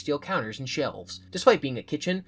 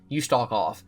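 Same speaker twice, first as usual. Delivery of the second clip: ordinary delivery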